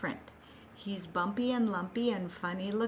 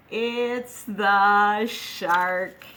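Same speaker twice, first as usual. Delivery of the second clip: dramatic shark announcement; 9 dB louder, much slower than usual. Acted. Introducing the shark in song.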